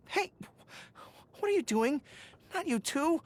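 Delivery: high, startled protest; embarrassed yelp of protest; flustered surprise. Acted. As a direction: caught off guard, pulling away. Someone reaches for his ears.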